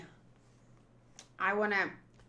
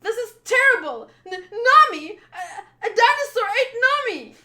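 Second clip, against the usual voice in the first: high, loud, stammering panic; frantic, horrified yelling; distraught shouting; 9 semitones higher, 9 dB louder than usual. Acted. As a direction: shout the awful news, tripping over words. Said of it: "he's shouting for Luffy".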